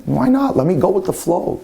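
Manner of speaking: a breezy, up-in-pitch retold reply; carefree acceptance. Young man accepting a Sabbath invitation, carefree.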